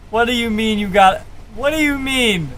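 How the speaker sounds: ordinary delivery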